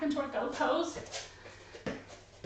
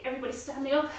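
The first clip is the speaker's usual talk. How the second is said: usual speaking voice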